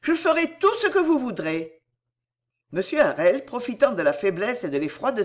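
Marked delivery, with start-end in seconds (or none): 0.0-1.8 high-pitched frantic promise; urgent cry, voice lifted high; fervent exclamation. A narrator's reading. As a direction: promise anything to save him. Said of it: "s'écria Cécile".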